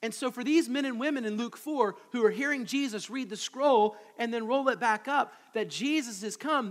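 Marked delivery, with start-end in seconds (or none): none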